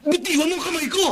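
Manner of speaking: high yell to let go of his hand; angry shout. Acted. Husband orders her to let go. (sumisigaw na utos)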